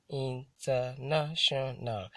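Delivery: "international" slowly broken into separate syllables; slow, segmented "international" for counting syllables. Shown as a demonstration. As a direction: sound out "international" one syllable at a time. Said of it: "That's a five syllable word".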